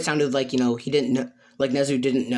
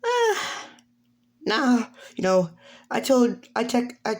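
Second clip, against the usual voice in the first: high-pitched character voice; hesitant, high-pitched reply; Cammy; 9 semitones higher than usual. Acted. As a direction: start very high, casually declining. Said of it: "And she goes".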